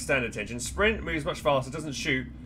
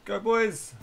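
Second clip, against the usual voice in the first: excited cheer; 8 semitones higher, 3 dB louder than usual. Real, not acted. Cheering soldiers on.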